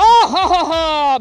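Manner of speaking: big, loud, deliberate ha-ha; tiger's mocking laugh. Tiger laughing at the dog biting his ankle, loud and mocking. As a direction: laugh heartily at a puny attacker. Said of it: "And then the, the tiger was like".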